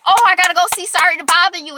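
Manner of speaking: fast, high, loud burst of delight; excited realization; sudden excitement. Real, not acted. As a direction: blurt it out, thrilled.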